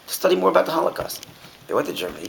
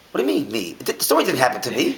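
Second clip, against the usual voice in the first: higher, baffled cab driver; puzzled protest; 6 semitones higher than usual. Retold or imitated. Driver baffled when the rabbi turns the story on him. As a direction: protest it's not about you. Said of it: "He goes".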